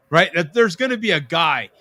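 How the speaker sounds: normal talking voice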